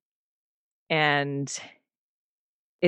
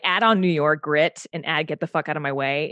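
ordinary talk

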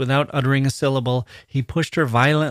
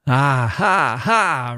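roaring laugh; 3 semitones higher, much slower than usual. Acted. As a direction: roar with laughter as the king. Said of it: "roared the latter".

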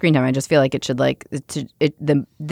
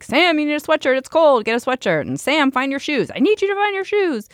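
high-pitched mock yell at a child; harried parent's high-pitched call, acted out; retold shouting; 11 semitones higher than usual. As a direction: re-enact hollering orders from another room. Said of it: "shouting from the bathroom".

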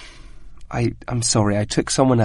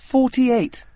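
sheepish confession; 8 semitones higher than usual. Real, not acted. Admits failing the test many times.

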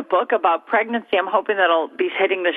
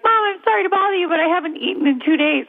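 put-on higher, faster little-girl voice; little daughter voice; 8 semitones higher than usual. Mock little daughter politely reminding mom, higher child voice. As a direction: chirp it like a neglected small child. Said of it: "Ruby will be like".

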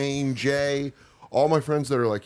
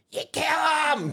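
imitated screaming; 12 semitones higher, faster than usual. Eagles fans still heckling a losing visitor, mock screaming. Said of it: "Just screaming".

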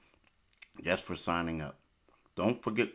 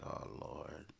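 breathy whisper; 3 semitones lower, 16 dB quieter than usual. Retold or imitated. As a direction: whisper it under your breath. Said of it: "And I'm like".

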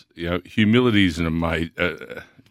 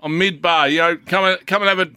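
high, loud, stretched over the bar noise; calling out; 9 semitones higher, 4 dB louder than usual. Retold or imitated. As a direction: yell it down the phone from the bar. Retold phone call, loud bar.

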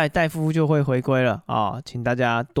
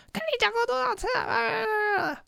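much higher, strained mock screaming mother; furious yell; 5 dB quieter than usual. Mother screaming at her son downstairs, imitated furious yell. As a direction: scream it like an enraged parent. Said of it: "暴怒的大吼说".

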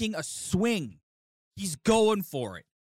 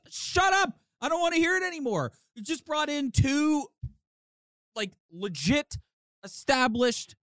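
exasperated retort; 6 semitones higher than usual. Pretend. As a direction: cut the complainers off, pitched right up. Exasperated retort to complaining fans.

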